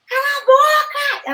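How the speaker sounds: high, slow shout of shut up; in-character shout. Retold or imitated. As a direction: yell it like a character losing it. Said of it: "E aí eu falei". (grito de personagem, agudo e lento)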